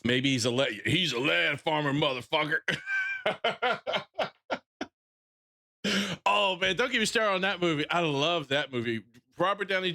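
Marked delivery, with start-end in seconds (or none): 2.6-4.6 a sudden high laugh that breaks out; burst of laughter. Real, not acted. Streamer laughs at his own crude punchline, high burst of laughter.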